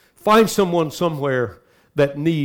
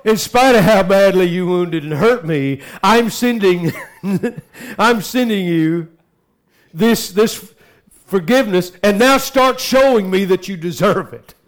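loud, high, aggrieved mock pardon; grudging forgiveness, hammed up with a laugh; mock bitter forgiveness; 5 semitones higher, 7 dB louder than usual. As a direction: act out resentment, voice pushed up and loud. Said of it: "Do not write something like this".

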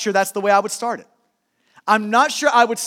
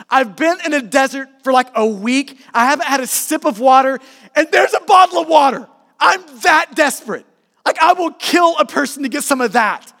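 raised, urgent voice building in pitch and volume; acted desperation; 3 semitones higher, 5 dB louder than usual. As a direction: play someone parched and frantic for water. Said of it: "I'm talking, like, this sort of desperate".

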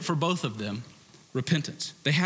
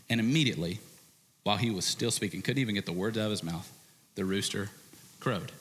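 usual speaking voice

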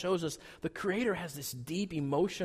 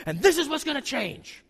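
mock outburst; 9 semitones higher than usual. Spouse at the fight's climax.

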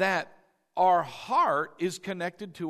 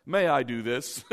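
hammed-up, very proper request; mock formal. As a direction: ask permission with comic formality. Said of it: "that seems formal to me".

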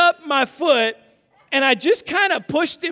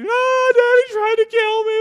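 high, loud mock bawling; melodramatic wailing child; 12 semitones higher, 6 dB louder than usual. Dad mocking son's claim to mom, melodramatic wail. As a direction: wail like a kid faking mortal injury.

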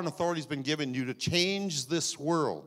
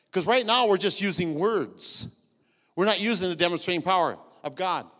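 loud, rising preacher voice; preaching; 3 semitones higher, 6 dB louder than usual. Real, not acted. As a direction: build the sermon with fire. Preaching builds up.

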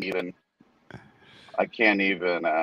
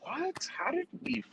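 baffled; 13 semitones higher, 5 dB quieter, slower than usual. Retold or imitated. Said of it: "and we were like".

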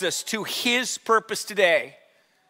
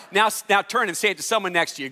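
ordinary delivery

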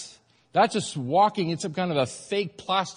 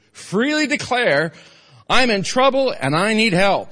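loud earnest plea; 4 semitones higher, 7 dB louder than usual. Acted. Preacher urging the church, loud plea.

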